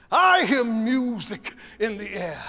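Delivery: preaching it loud and slow; preacher. Shown as a demonstration. Conductor imitates a preacher, loud and slow. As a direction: proclaim it like a preacher from the pulpit. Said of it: "we are imitating that preacher".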